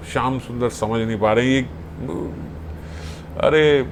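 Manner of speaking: ordinary talk, nothing marked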